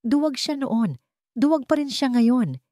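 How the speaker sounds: ordinary delivery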